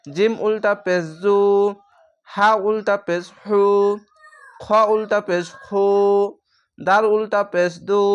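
each letter with উল্টা পেশ, the final u drawn long; letter-by-letter recitation. Shown as a demonstration. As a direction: chant through the alphabet, stretching each u. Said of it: "এক আলিফ টেনে অবশ্যই পড়বেন".

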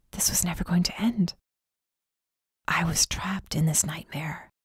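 soft unvoiced whisper; whispered despair. A narrator's reading. Trapped narrator realizing the nightmare never ends, whispered.

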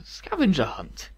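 quiet, slow puzzled echo of scavenger hunt. Acted. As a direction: mull it over, frowning. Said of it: "Aurora frowned".

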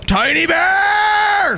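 high, long drawn-out holler; excited shout. Real, not acted. Streamer thanking a viewer for gift subs, excited drawn-out shout. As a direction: call it out high, stretching the last word.

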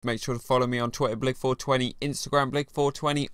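loud, brisk outro read; sign-off plug. Acted. Host tells viewers to follow and subscribe, loud brisk sign-off. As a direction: rattle off the social plugs at high volume.

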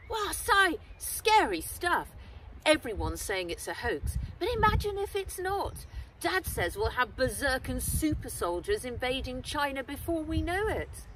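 chatty schoolboy on the phone; young Wen. Acted. Wen answering Henry's late call, eager chatty boy.

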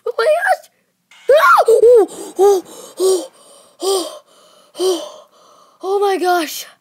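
very high, loud shrieking gasps; shaken screams. Real, not acted. Gamer screaming after the jumpscare. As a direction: scream and pant after the scare.

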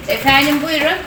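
upbeat phone sales voice; customer service voice. Acted. Agent pitching carpet, raised.

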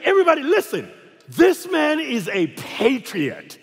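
high-pitched proclamation, slowing grandly at the end; hammed-up address to everyone around; mock public announcement. Friend pranks him before the whole flight, mock proclamation.

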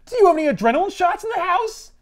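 high, laughing parody of her; mocking imitation. Spider-Man mocking Felicia's question, high parody. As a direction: mimic her question teasingly. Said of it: "And he goes".

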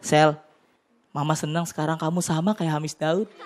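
a hint of a gently proud mom; mother praising her son after seeing him on TV; mom's voice. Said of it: "mama gue bilang gini".

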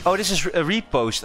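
ordinary delivery

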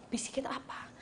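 hushed, voiceless whisper; whispered question. Retold or imitated. Mother whispers a question to her little son. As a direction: whisper it back as if sharing a secret.

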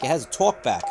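usual speaking voice

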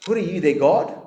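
ordinary talk, nothing marked

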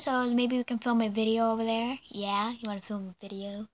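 usual speaking voice

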